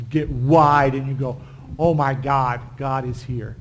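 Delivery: ordinary talk, nothing marked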